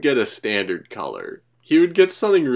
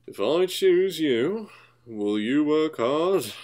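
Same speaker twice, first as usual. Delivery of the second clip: very slow, deliberate, higher-pitched voice; stern Fat Controller; 6 semitones higher, much slower than usual. Acted. Fat Controller testing the newcomer after silence, deliberate. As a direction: draw out each word like an official. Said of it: "the Fat Controller is just like".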